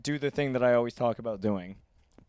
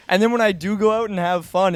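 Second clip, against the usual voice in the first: normal talking voice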